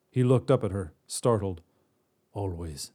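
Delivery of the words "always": soft, low confession; whispered. Acted. Broken god answers the witch, soft and low. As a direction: breathe the word out softly. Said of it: "he whispered".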